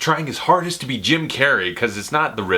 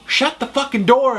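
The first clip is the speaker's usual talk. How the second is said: much higher, fed-up shout at the butler; exasperated yell; 12 semitones higher than usual. Acted. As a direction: yell it like you've had it with him. Ranting at Alfred about Batcave door.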